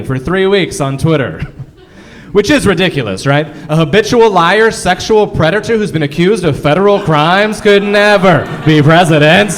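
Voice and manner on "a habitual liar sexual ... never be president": mock outrage. Mock outraged political rant.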